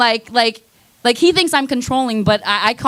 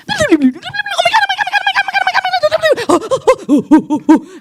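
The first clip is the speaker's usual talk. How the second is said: high mock moaning laugh. Porn scene mock laugh.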